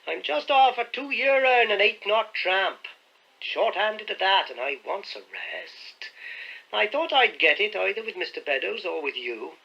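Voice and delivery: slow, high rough sailor; a lightly voiced crafty seaman; old sailor voice. A narrator's reading.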